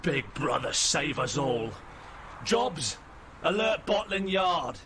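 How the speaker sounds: climbing from dismayed oath to high urgent order; alarmed officer. Acted. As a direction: call for help as a shaken robot policeman. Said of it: "He says, uh".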